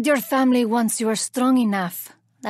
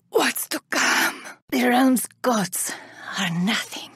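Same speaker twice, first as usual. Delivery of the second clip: menacing whisper; 4 dB quieter than usual. Acted. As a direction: hush it like a dark secret. Menacing threat, voice drops.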